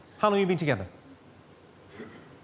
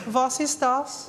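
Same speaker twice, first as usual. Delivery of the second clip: put-on German accent, slow and high; lightly mocking German voice; 5 semitones higher, much slower than usual. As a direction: ham up a German asking what this is.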